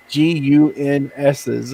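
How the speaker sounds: mock the guy spelling "G U N S's" one letter at a time, slow. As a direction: slowly spell out "G U N S's" letter by letter, mimicking the Australian. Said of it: "the dude spelt out the word gun".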